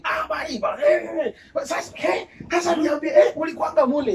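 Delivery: high, loud, excited greeting in mimicry; intrusive acquaintance. Acquaintance barging into a lunch meeting, loud and pushy. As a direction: imitate an over-familiar pal calling out loudly.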